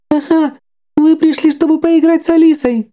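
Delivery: very high, sing-song creepy doll; jerky animatronic voice. Acted. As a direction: play it like a haunted toy. Doll Alice speaks.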